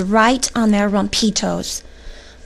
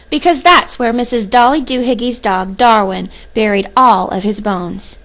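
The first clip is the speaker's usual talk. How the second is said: ordinary delivery, nothing marked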